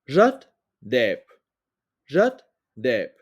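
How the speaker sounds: "jot dep" said slowly, syllable by syllable, as a pronunciation model; Vietnamese example word. Shown as a demonstration. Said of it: "comme dans l'exemple".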